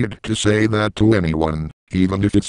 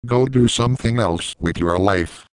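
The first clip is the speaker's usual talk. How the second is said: ordinary talk, nothing marked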